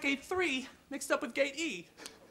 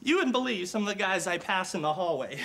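ordinary talk

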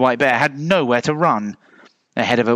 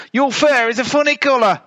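high, insistent retort; high-pitched childish comeback; little bear; 13 semitones higher, 3 dB louder than usual. A narrator's reading. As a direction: contradict in a small high voice. Little bear taunts polar bear.

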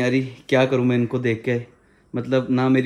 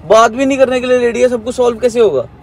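raised, urgent pleading voice, calling after her; loud pleading; 9 semitones higher, 7 dB louder than usual. Real, not acted. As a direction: beg her to stop and listen, loud and high. Pleading during heated quarrel. (प्रेमिका को रोकते हुए ऊँची आवाज़ में गिड़गिड़ाना)

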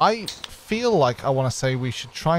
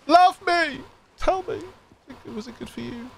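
much higher, needy comic voice; 13 semitones higher than usual. Pretend. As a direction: beg for praise like a needy lover. Needy comic plea for approval.

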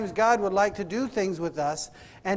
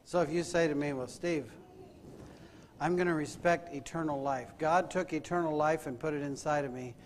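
soft, lowered, searching questions; hushed preaching; 4 semitones lower, 8 dB quieter than usual. Acted. Preacher challenging congregation about this week, hushed solemn preaching. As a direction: bring it right down and let each question sit.

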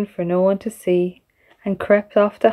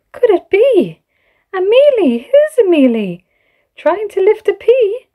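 high, louder disbelieving questions; can't believe your eyes; astonished; 11 semitones higher, 3 dB louder than usual. Acted. Samson seeing Amelie on stage, high astonished disbelief.

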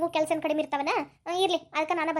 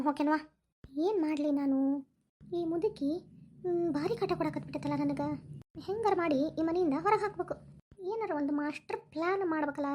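scheming mutter; 6 dB quieter than usual. Acted. Scheming alone against mother-in-law.

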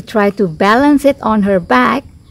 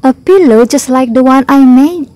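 high, loud astonishment; astonished storybook panda; amazed; 5 semitones higher, 5 dB louder than usual. Acted. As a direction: exclaim at a surprising find.

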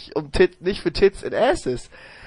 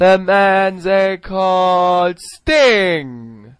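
very slow, louder wrestling introduction; ring announcer voice; 5 dB louder, much slower than usual. Pretend. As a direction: drag out each word like a big entrance call. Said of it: "Jeremy Borash sagt das Match an".

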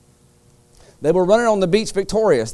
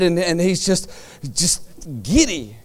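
usual speaking voice

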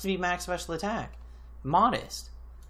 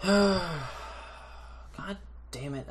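stretched-out, very slow weary curse; slow defeated groan; 3 semitones higher, much slower than usual. Real, not acted. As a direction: drag it out, worn down.

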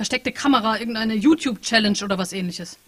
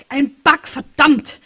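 louder, higher burst on Bug; loud curse; 6 semitones higher, 10 dB louder, much slower than usual. Acted. The bug cursing at its own bug status, loud. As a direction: spit out the word Bug, then curse.

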